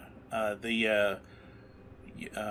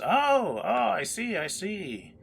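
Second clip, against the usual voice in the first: put-on high, loud old woman understanding; old woman's voice; 11 semitones higher, 8 dB louder than usual. Game master voicing the old woman as she understands the strangers. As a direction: let the old woman realise it with relief. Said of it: "the same voice, says".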